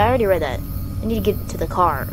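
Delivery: ordinary talk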